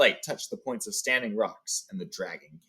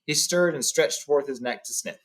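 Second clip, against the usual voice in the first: plain reading voice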